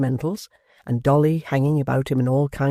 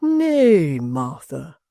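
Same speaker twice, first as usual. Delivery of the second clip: cried out; 3 semitones higher, 5 dB louder, much slower than usual. A narrator's reading. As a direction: call out in protest. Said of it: "cried the locksmith".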